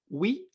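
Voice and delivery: "wi" with u gliding straight to i; wrong pronunciation. Shown as a demonstration. Teacher warns what is never heard in Chinese, wrong pronunciation. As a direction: say "wi" like French oui. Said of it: "Nunca vamos a escuchar".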